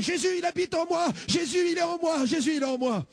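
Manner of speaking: high-pitched cries of joy; joyful shouts. Retold or imitated. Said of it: "en poussant des cris". (crier de joie, aigu)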